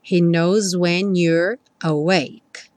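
very slow, "knows" ending in Z; Z-linked knows. Shown as a demonstration. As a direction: buzz the S of "knows" into "when". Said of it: "o S ganhou um som de Z". (S de "knows" com som de Z)

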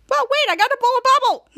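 high, loud hurried-sounding plea; frantic objection. Retold or imitated. Ray needs to blow a bubble, frantic protest. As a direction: protest as you're being left.

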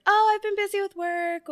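high, breezy small-talk voice; cheerfully guarded small talk; customer service voice. Retold or imitated. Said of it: "they get the real basic".

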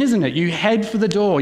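ordinary talk, nothing marked

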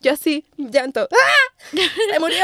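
high sobbing wail; hammed-up sobbing panic; mock hysterical crying. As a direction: act out crying that he died.

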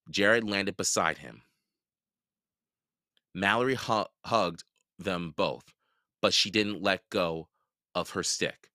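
plain reading voice

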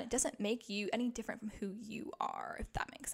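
unvoiced whispered aside. Real, not acted. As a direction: whisper it like a secret.

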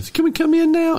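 squeaky high fan voice; groupie voice. Retold or imitated. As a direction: pipe it up like an eager fan. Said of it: "the groupies calling in".

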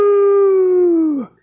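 high loud ghost wail; ghostly woo. A narrator's reading. Ghost sound in dad's story.

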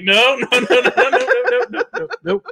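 high rapid-fire refusals, half laughing; retold panic. Teller led by the hand refuses, retold panic. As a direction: play up frantic backing away. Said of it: "It was scary as hell".

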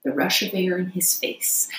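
usual speaking voice

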